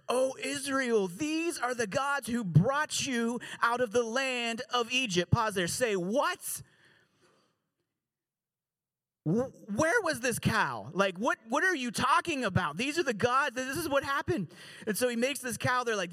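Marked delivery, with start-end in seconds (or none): 0.1-5.3 slow, raised, grand announcing of the new gods; exclaimed proclamation. A narrator's reading. The crowd hails the golden calf in a slow, grand voice. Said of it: "they exclaimed". 6.0-14.5 incredulous. Pretend. Mock disbelief at the calf.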